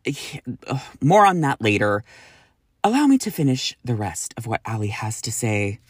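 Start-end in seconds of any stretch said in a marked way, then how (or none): none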